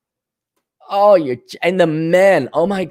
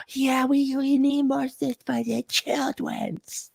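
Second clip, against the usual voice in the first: high, soft, slow and simpering; softer, higher, slower mock voice; mock pious parent; 8 semitones higher, 8 dB quieter, slower than usual. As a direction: simper about the children. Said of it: "mocking parents".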